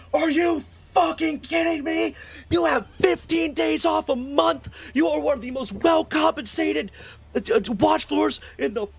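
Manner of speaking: high, strained admiral's tirade; sustained imitated angry yell; retold furious yelling. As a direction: act out an officer screaming in disbelief, held back in volume. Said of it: "how loud he's yelling".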